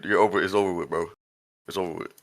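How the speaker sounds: usual speaking voice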